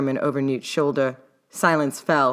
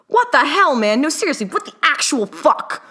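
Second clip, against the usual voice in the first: high, loud outraged outburst; exploding in anger; 11 semitones higher, 5 dB louder than usual. A narrator's reading. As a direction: blow up at him all at once. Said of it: "the ticking time bomb that was Newton exploded".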